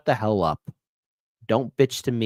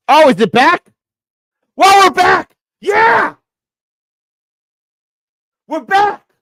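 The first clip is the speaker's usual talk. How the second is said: much higher and louder, jubilant; excited shout; 14 dB louder than usual. Real, not acted. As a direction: yell with relief that it's back. Stream comes back online.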